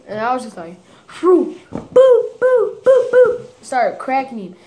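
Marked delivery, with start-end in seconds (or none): 1.1-3.4 high, loud rapid boos; cartoon fight noises, pitched way up; punch sound effects. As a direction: imitate landing a flurry of punches. Imitating punches thrown in retold fight.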